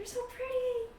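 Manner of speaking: high-pitched and adoring; gushing compliment. Acted. Wife compliments the general's wife, high delighted gushing. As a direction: gush admiringly up high.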